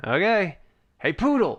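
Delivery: high call for the poodle; boss calling. Acted. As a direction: sing out to the poodle. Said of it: "you hear him say".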